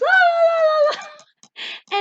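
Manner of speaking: high, loud imitated wail while falling; hammed-up high wail of someone toppling; mock tumbling wail. Imitates girl tumbling with laptop.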